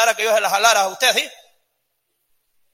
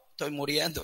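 feeble; 9 semitones lower, 12 dB quieter than usual. Retold or imitated. Sick member complains to pastor.

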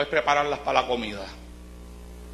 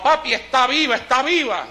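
put-on higher, lightly raised voice of an alarmed boy; retold child's cry; 8 semitones higher than usual. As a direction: imitate a child calling out to his dad. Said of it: "yo le gritaba".

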